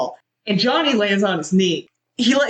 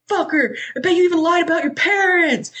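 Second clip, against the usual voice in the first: mock angry yell; 11 semitones higher than usual. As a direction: hurl it like a betrayed friend. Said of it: "yells at him".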